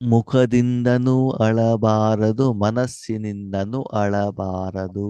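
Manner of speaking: very slow, stressing ಮುಖದಿಂದನೂ and ಮನಸ್ಸಿನಿಂದನೂ; slow, deliberate preaching stress; slow emphasis. Acted. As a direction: draw out each phrase like a lesson point. Teacher stresses a maxim.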